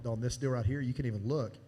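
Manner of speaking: ordinary delivery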